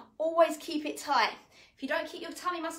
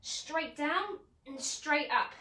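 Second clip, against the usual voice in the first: slow demo cue; much slower than usual. Shown as a demonstration. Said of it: "I'll show you".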